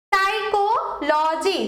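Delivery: Psychology drawn out slowly, syllable by syllable; slow, separated psychology, leaning on কো; stress on third-last syllable. Shown as a demonstration. Teacher showing students where stress falls, slow syllable-stressed demo. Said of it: "third এর ওপর হচ্ছে আমার কি হবে".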